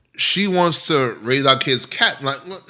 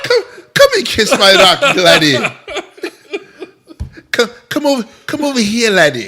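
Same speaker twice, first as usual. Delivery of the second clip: mock Irish priest; 6 semitones higher than usual.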